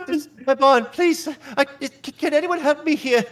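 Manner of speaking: high, pleading falsetto plea; distressed woman. Acted. Frightened farm woman pleading with the adventurers, high and desperate.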